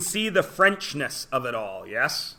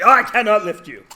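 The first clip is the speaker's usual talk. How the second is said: loud, higher put-on French voice; French dude refusing the piggyback, hammed-up; mock French exclamation; 6 semitones higher, 10 dB louder than usual. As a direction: blurt it out in a hammed-up French accent.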